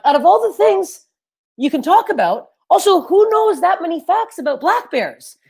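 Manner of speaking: high-pitched incredulous complaint; comic exasperated rant; mock exasperation. Acts exasperation at bear rant.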